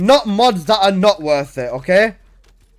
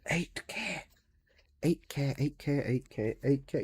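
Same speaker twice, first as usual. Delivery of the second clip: much softer, repeated in disbelief; stunned murmur; 5 semitones lower, 15 dB quieter than usual. Real, not acted. Streamer repeating the price, softly stunned. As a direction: mutter it over and over, unable to believe it.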